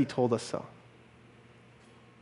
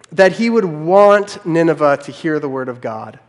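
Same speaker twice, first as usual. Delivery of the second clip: ordinary talk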